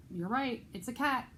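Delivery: ordinary delivery, nothing marked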